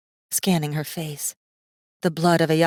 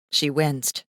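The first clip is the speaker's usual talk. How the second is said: ordinary delivery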